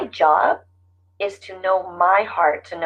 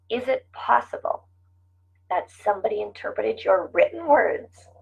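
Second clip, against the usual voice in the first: ordinary talk, nothing marked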